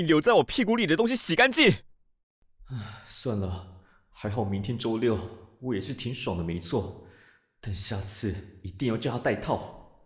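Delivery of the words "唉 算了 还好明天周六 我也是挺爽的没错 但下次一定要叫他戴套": much lower, softer inner voice; resigned musing. Acted. Senior gives up and thinks to himself, resigned musing. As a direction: sigh and grumble privately, low and soft. Resigned private thoughts after scolding.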